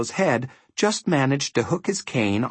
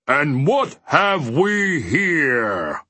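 slow, drawn-out giant roar, raised in pitch; giant's roar; 4 semitones higher, much slower than usual. A narrator's reading. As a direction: roar it out slowly like a huge giant. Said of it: "he roared".

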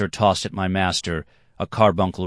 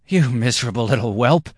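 higher, louder outburst; angry insult; 6 semitones higher, 5 dB louder than usual. A narrator's reading. Stumbling dancer insults the boy, angry. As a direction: spit the insult at him.

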